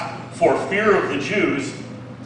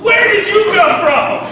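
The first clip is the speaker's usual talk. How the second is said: startled disciple; 11 semitones higher than usual. Acted. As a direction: ask it like a frightened man.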